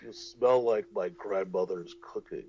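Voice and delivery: low, soft, creepy lover voice; creepy seductive whisper. Pretend. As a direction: murmur it like a greasy seducer.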